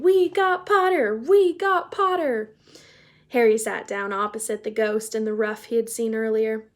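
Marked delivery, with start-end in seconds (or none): 0.0-2.5 higher, louder gleeful cheer; yelling twins. A narrator's reading. Weasley twins cheering Harry's sorting across the hall, yelling. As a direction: yell it across the hall in triumph. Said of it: "while the Weasley twins yelled".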